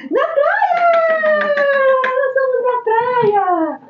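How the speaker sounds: high, slow, thrilled exclamation; excited. Real, not acted. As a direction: announce it with delight. Excited retelling to camera.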